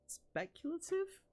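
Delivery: word drawn up into a high, slow question; unsure of the word, let it climb; questioning rise. Real, not acted. Reader doubting the word in the book, high, slow questioning rise. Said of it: "I like how I question it".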